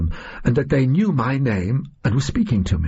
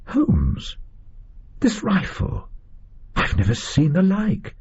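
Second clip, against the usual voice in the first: high, amazed exclamation at ordinary volume; astonished; 9 semitones higher, slower than usual. Acted. Watson to Holmes on seeing the strange rifle, astonished. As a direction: marvel in a raised pitch, without shouting.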